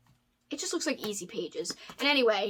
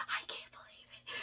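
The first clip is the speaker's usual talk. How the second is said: teary whisper; 16 dB quieter than usual. Real, not acted. Overwhelmed by handmade gift.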